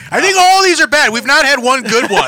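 high, loud disgusted outburst with laughter; disgusted shout. Real, not acted. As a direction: yell it out, half laughing. Reacting to awful flavours.

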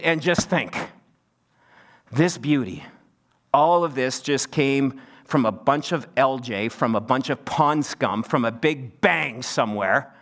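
mock the big bang idea with dry sarcasm; sarcastic. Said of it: "we said this sarcastically".

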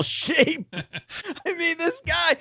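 high, squeaky laughing talk; incredulous laugh through the words. Real, not acted. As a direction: crack up while talking. Laughing at the out-of-shape player.

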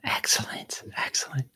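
quick whispered aside; whispered approval. Real, not acted. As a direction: whisper it under your breath. Host approves between accent rounds.

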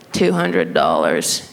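low, slow clerk voice; slow, low mock-clerk delivery; store staff. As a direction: imitate staff naming a price, dropped low and drawn out. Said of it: "And they said".

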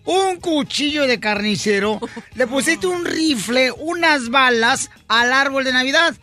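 scolding mother. Acted. Joke mother asks son about the decorated tree, scolding. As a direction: play an appalled mum questioning her son. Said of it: "llega la mamá y le dice al hijo".

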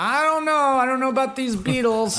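high-pitched mock hesitant voice. Imagining producer doubting the Beatles, mock hesitant. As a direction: play a record producer unsure about the band. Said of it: "Can you imagine that? Just like".

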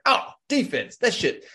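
high, scoffing dismissal of defense; mock Deion waving off defense, high and scornful; dismissive Deion. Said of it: "Deion Sanders is like".

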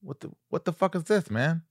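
high, puzzled Tyson imitation; lightly voiced baffled Tyson; Mike Tyson. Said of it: "he goes".

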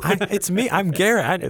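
a high, loud put-on Garrett; mock Garrett. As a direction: claim to be Garrett in a hammed-up voice. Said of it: "You don't even sound like him".

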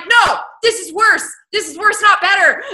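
high, loud laughing protest; exasperated protest. Retold or imitated. Reacting to the wife getting pregnant, high loud dismay. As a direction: shout it in disbelief at the plot. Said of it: "I'm like".

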